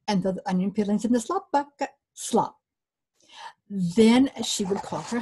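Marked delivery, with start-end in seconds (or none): none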